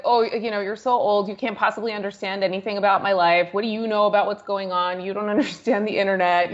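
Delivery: usual speaking voice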